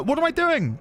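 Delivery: usual speaking voice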